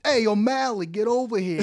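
East Coast Irish-Italian. Acted. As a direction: call him over in a mock East Coast accent. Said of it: "'Cause he's Irish".

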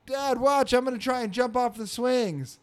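put-on high-pitched little boy calling to his dad; eager kid, mimicked. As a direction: pitch it up like a child wanting attention. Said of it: "and his son's like".

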